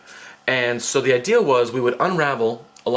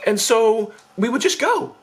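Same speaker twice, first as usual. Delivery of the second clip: ordinary talk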